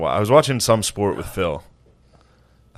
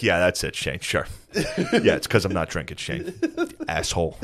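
low, muttered imitation of an irritated dad; quiet, sarcastic mock irritation; retold grumpy anger; 4 semitones lower, 6 dB quieter than usual. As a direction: grumble it sourly under the breath. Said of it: "He's always so fucking angry".

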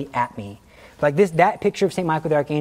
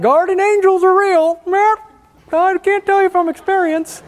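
high, slow, silly mock-earnest voice; mock lecturer; 6 dB louder than usual. Teller mocking a bad class talk in a high voice. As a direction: parody a lame class speaker.

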